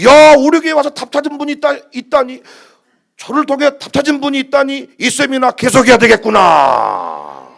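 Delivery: loud, high, thrilled exclamation; delighted self-quote. Retold or imitated. Preacher rejoicing that someone found answers, loud and high. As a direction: crow with joy at finding someone helped.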